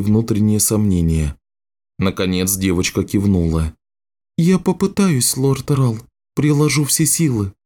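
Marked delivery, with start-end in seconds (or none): 4.4-7.5 put-on high-pitched girl promising to try; earnest girlish character voice; young girl's voice. Girl agrees to try healing.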